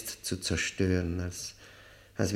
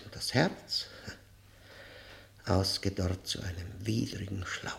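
a dry, disgusted whisper; 2 semitones lower, 6 dB quieter than usual. Acted. Prisoner whispering his inner ruin to the visitor. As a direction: breathe it out in revulsion.

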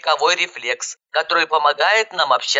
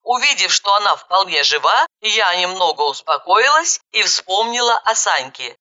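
ordinary delivery

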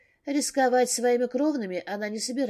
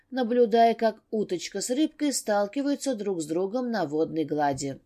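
ordinary delivery, nothing marked

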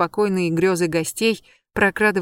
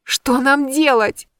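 high, loud, slow plea; loud alarmed asking; panicked question; 7 semitones higher, 5 dB louder, slower than usual. A narrator's reading. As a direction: cry it out in alarm.

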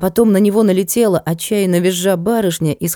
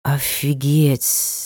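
serpent voice; 4 semitones lower, 4 dB quieter, much slower than usual. A narrator's reading. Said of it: "подтвердил змей".

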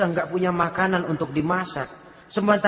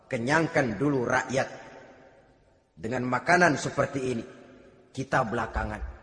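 ordinary talk

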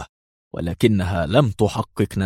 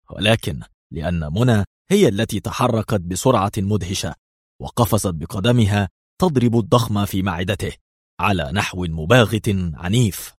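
ordinary delivery, nothing marked

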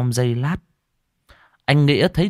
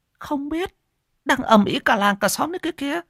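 very high, fast chattering gossip; busybody villager spreading the news fast and high; high gossiping village woman. Acted. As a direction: rattle it off like a nosy neighbour.

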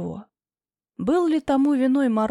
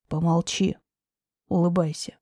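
lower, curt man's voice; low firm command; 5 semitones lower than usual. Acted. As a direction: hush her, firmly and low.

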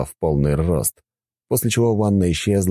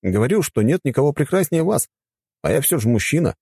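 put-on lightly higher, courteous young man; polite young man voice; 9 semitones higher than usual. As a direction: speak as a respectful young god. Gauss flatters the goddess. (вежливый молодой мужчина)

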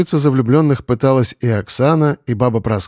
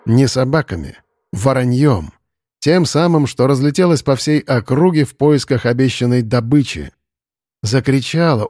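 ordinary delivery, nothing marked

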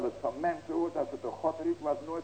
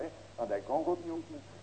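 ordinary delivery

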